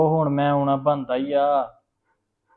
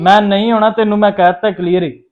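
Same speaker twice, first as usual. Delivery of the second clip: louder, higher angry insistence; angry refusal; 5 semitones higher, 9 dB louder than usual. Acted. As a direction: put your foot down, furious. Refuses to return while father's there.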